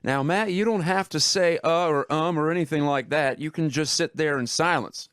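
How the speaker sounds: high-pitched professor imitation; Chuck Bailey. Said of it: "in that high-pitched voice of his".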